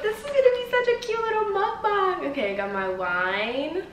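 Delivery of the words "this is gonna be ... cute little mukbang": much higher, sing-song cutesy voice. Real, not acted. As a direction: gush about it in a cute voice. Excited about the cute meal.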